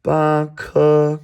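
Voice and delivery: "ba ke" loud, high and level; high level tones. Shown as a demonstration. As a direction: hold both syllables of "ba ke" on one high note. Teacher models the tones.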